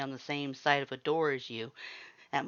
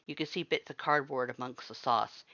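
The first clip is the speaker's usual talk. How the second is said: plain reading voice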